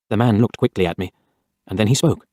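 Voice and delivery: ordinary delivery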